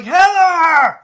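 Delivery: high, loud scream of her name; screaming for Heather from the bathroom. Retold or imitated. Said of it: "I'm screaming".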